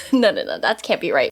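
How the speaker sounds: ordinary delivery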